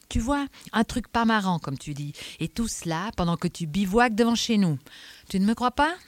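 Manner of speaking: insistent inspector with biting emphasis; scornful Adamsberg. Acted. Inspector tells the eccentric about a killer's letters, scornful and insistent. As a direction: press the facts on him.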